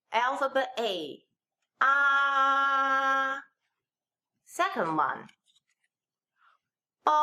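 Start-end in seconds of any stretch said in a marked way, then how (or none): 1.8-3.4 "ah" held long on a level note; first tone. Shown as a demonstration. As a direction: draw out "ah" without any pitch movement. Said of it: "first tone".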